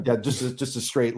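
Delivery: ordinary talk, nothing marked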